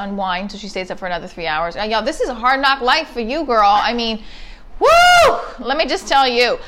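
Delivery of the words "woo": very high, loud woo; exasperated whoop. Real, not acted. Venting about her ex's parenting, shrill exasperated whoop. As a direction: let out a sudden piercing whoop. Exasperated outburst mid-rant.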